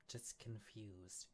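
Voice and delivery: soft, slow admission of confusion; hushed and hesitant; subdued confession. Acted. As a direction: confess it quietly, drawing the words out. Acted confession of confused feelings.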